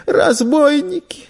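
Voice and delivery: put-on very high, slow child's answer; thin, tearful little voice; holding back sobs. A narrator's reading. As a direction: squeeze it out through held-back tears. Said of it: "сдерживая рыдания, тихо сказал мальчик".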